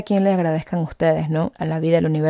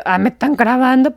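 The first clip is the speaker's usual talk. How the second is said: startled; 5 semitones higher, 5 dB louder than usual. Retold or imitated. Noticing being filmed.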